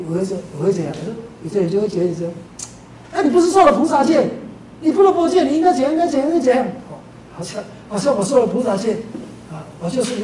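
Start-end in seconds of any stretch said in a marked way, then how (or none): 3.1-6.7 high, loud mock lecturing voice; mock scolding. Mimicking people lecturing about bodhisattva vows, high mock scolding. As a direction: nag him like a preachy busybody.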